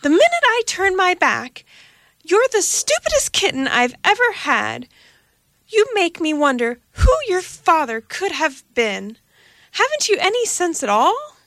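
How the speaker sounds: high, raging cat tirade; furious mother cat. A narrator's reading. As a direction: scold the kitten in a rage. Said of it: "swelled with rage".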